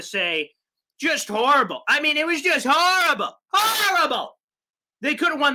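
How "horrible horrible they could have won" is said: one word yelled in disgust; disgusted shout. Real, not acted. Ranting about the loss.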